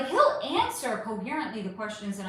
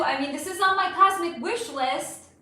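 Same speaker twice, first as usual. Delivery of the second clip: normal talking voice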